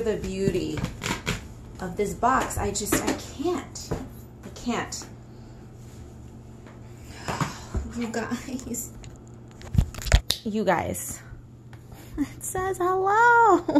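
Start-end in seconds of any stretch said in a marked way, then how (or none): 3.4-8.9 breathless whispered awe. Real, not acted. Telling viewers how beautiful the box is, whispered. 12.2-13.6 slow, higher cutesy voice. Real, not acted. Reading the box's greeting in a slow, high cutesy voice.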